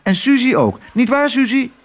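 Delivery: hoge, piepende muizenstem. Acted. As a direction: een muisje dat avontuur wil. Lodewijk de muis spreekt. (hoge muizenstem van Lodewijk)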